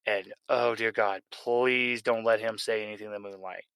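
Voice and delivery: ordinary delivery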